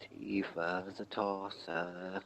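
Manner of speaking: very soft, slow, drawn-out teasing; teasing hushed call. Pretend. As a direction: tease a sleeper very softly, stretching the words. Teasing a supposedly sleeping friend.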